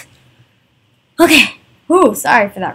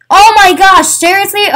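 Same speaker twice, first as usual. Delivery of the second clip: exasperated outburst; 7 semitones higher, 5 dB louder than usual. Real, not acted. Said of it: "it just gets on my nerves".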